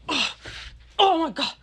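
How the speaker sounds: high, mock outraged groaning; exasperated huffing, imitated. Imitating fed-up player storming off, exasperated. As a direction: huff each 'oh' apart, fading.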